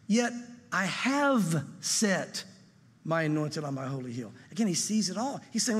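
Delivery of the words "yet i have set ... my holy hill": very slow, hushed scripture reading; slow, hushed reading of God's decree; solemn proclamation. Acted. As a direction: let the decree fall slowly and low. Repeats verse slowly, quieter.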